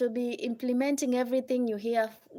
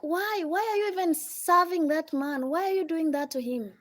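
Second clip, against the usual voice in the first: nagging friend; 5 semitones higher, 4 dB louder than usual. Retold or imitated. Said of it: "They're like".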